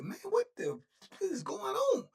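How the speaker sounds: high, cracking cry of panic; panicked disbelief. Retold or imitated. Seeing his homies bleeding, he cries out in panic. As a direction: voice breaking with alarm at the blood. Finds friends bleeding on the floor.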